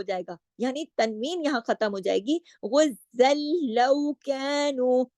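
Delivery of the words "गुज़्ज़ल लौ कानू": slow chanted recitation linking गुज़्ज़ल into लौ Kanu; joined recitation. Shown as a demonstration. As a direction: chant slowly, joining the words. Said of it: "मिला के पढ़ने में".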